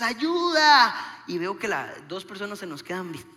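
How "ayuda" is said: raised, drawn-out mock distress call; shouting for help. Couple yelling early to the boat, acted shout. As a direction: call loudly to a faraway boat. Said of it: "Empezamos como a gritar desde antes".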